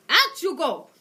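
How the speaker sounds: loud, high dismissive shout; shouting witch. Acted. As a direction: order them out loudly. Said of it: "she shouted".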